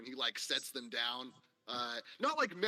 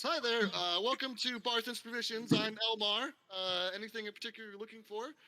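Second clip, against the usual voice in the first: higher, cheery shopkeeper greeting; friendly shopkeeper; 10 semitones higher than usual. Acted. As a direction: welcome the customer brightly. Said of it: "and he says".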